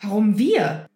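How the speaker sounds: loud protest; indignant outburst. A narrator's reading. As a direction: burst out in defence. Harry protestiert gegen Snape. (Harry protestiert laut gegen Snape)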